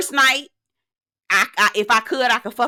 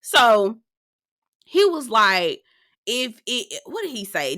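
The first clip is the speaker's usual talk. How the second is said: ordinary talk, nothing marked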